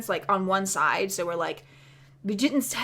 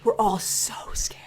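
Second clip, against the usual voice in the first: whispered fear. Real, not acted. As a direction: whisper it like you're still hiding.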